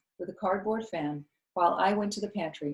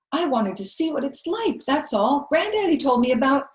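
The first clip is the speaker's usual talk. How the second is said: put-on high, louder girl's protest; child voice; 8 semitones higher, 8 dB louder than usual. A narrator's reading. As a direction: plead your case like a kid. Child explaining to Viola.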